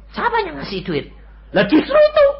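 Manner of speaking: high playful imitation; squeaky mock voice. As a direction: mimic the thought in a high squeak. Mock reasoning voice.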